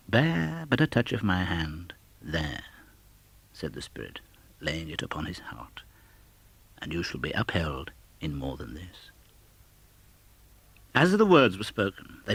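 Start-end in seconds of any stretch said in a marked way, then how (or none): 0.1-9.1 reassuring spirit. Acted.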